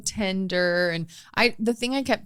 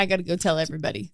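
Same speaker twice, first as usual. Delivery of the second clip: fast excited; 6 dB quieter, much faster than usual. Retold or imitated. Retelling waitress glee.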